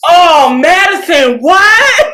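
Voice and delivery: loud, high mock shock; hammed-up astonishment. David hearing Madison's confession, hammed-up astonishment. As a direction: cry it out in exaggerated surprise.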